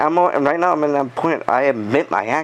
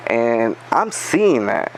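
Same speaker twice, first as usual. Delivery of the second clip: ordinary delivery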